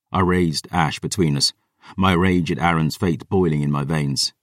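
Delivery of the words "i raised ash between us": usual speaking voice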